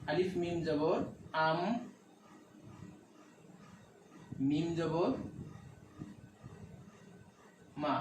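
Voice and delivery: name the letters, stop, then sound "আম", later "মা"; broken into letters. Shown as a demonstration. Teacher breaking letters apart for learners, broken into letters. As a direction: spell it piece by piece, long pauses before "আম" and "মা". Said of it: "অক্ষরগুলোকে একটু ভেঙে ভেঙে দেখাই".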